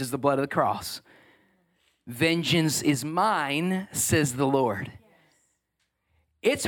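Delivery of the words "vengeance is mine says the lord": solemn scripture. Acted.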